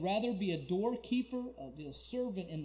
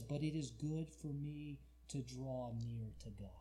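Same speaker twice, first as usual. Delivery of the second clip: slow, soft and low; gentle, low reverent hush; hushed scripture reading; 4 semitones lower, 6 dB quieter than usual. Acted. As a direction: read the verse slowly, almost whispering. Softly reads a tender verse.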